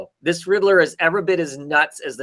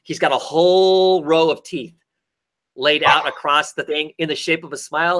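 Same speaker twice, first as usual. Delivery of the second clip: ordinary talk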